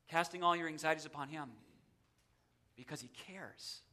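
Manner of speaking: quick, quiet lead-in, then "cares" singled out very softly; stress on cares. Shown as a demonstration. Preacher reassuring congregation of God's care, hushed stress on "cares". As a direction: drop soft and low, leaning gently on "cares". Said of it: "I like to emphasize different words".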